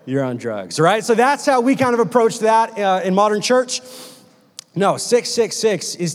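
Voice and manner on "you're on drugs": dismissive reply. Acted. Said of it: "And we're like".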